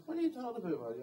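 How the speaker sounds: a sharp, high what-are-you-talking-about; incredulous retort. Retold or imitated. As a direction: ask it like you can't believe your ears. Retorting to an insulting remark.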